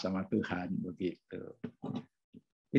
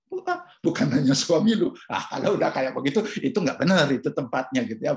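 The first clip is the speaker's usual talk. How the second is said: emphatic loud rebuke; 4 semitones higher, 6 dB louder, faster than usual. Real, not acted. Rebuking wives gossiping about husbands.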